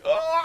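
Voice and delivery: long high-pitched wailing moan; hammed-up walrus-like groan; wordless moan. Co-host summing up this week, drawn-out wail. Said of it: "if there was a word for it this week".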